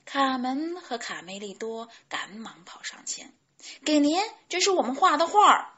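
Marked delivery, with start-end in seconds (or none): 3.8-5.7 high, loud, rapid chick chatter; eager child voice. A narrator's reading. Chick handing grandpa a drawing, eager and quick. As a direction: rush it out brightly and eagerly.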